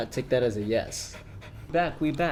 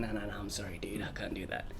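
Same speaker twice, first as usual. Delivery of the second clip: low, much softer apologetic brush-off; quiet refusal; 4 semitones lower, 14 dB quieter, faster than usual. Retold or imitated. Fake crew member refusing a pleading fan, quietly. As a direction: retell turning someone down, dropped low and soft.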